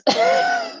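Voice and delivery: airy high laugh; breathy unvoiced laugh. Real, not acted. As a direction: laugh silently under breath. Laughing at herself.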